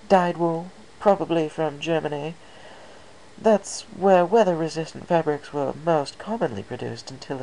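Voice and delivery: a hint of an elegant fashionista; lightly voiced Rarity. Rarity character voice.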